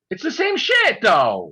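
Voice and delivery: sudden high shout of disgust; outraged outburst. Real, not acted. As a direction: blurt it out, fed up. Ranting about horror sequels.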